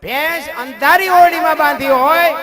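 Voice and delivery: high, loud driving sermon voice; full-force preaching. Acted. Preacher telling the buffalo parable to the crowd, loud and driving. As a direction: drive the lesson home to the crowd.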